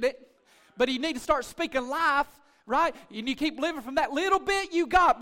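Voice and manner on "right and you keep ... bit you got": normal talking voice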